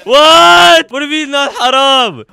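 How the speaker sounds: high, loud, stunned "what?"; real shock at what he just heard; stunned disbelief. Real, not acted. Streamer reacting to a caller's remark, shocked and loud.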